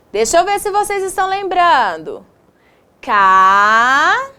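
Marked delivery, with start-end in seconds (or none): 3.0-4.3 "Ca" drawn out with a rising end; syllable dictation. Shown as a demonstration. Teacher quizzing pupils on dictated syllables, drawn-out dictation. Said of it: "Escreva a sílaba ditada".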